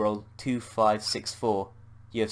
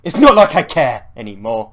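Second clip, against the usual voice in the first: much louder, bitter shout; raging; 2 semitones higher, 17 dB louder than usual. Acted. Bob raging at Anne in despair, loud. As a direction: throw it at her, nothing left to lose. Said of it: "taken over by rage".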